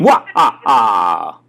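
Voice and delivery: loud staccato vampire laugh; booming puppet-vampire laugh; the Count. Acted. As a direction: count out a hammy vampire cackle. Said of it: "like the Count on Sesame Street".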